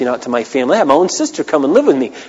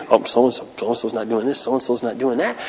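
mock judgmental muttering; 4 semitones lower, 9 dB quieter than usual. Imitating a judgmental inner thought.

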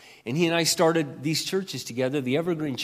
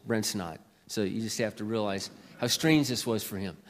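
ordinary talk, nothing marked

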